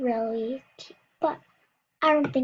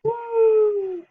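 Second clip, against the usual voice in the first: high loud whoop; excited whoop; 7 semitones higher, 6 dB louder than usual. Real, not acted. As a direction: cheer it out. Splash after cannonball.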